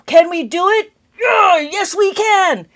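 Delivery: loud, high, shouted cartoon builder chant; angry Bob the Builder. To stream viewers, a loud angry Bob the Builder shout. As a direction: belt it out like a furious cartoon builder. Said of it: "that was like an angry voice of Bob the Builder".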